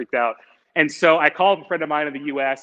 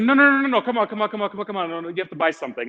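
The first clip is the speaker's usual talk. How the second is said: pushy shopkeeper; 5 semitones higher, much faster than usual. Retold or imitated. Shopkeeper pressing browsing customer to buy, high and pushy. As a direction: imitate a salesman who won't take no.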